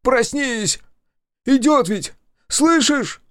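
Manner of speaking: very high, loud panic; frantic, climbing cries; howling plea. A narrator's reading. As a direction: wail desperately as danger approaches. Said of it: "взвыл тот".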